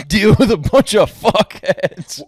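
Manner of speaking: exasperated laugh through the words, pushed up high and loud; laughing rant. Real, not acted. Complaining about rude moviegoers.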